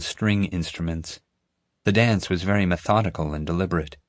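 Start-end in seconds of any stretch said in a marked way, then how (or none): none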